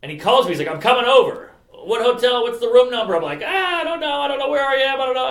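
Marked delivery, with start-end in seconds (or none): 0.7-3.1 higher, louder, urgent retelling; urgent phone demand. Retold or imitated. Manager urgently calls back, retold. 3.4-5.3 high-pitched, helpless panicky whine; mock panic. Panicked reply to manager, retold.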